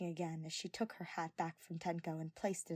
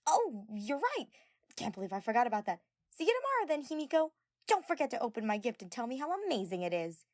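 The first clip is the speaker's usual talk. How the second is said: higher, louder, hurried exit lines; playing along; 6 semitones higher, 6 dB louder than usual. A narrator's reading. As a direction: fake remembering and dash off. Mew feigning, calling out leaving.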